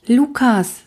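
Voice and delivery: usual speaking voice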